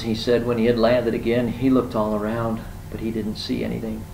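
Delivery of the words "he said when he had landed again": ordinary delivery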